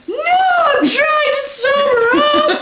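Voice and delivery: loud, high, slow wail; drawn-out drunken howl of protest; almost crying. Retold or imitated. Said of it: "I started to, like, cry almost".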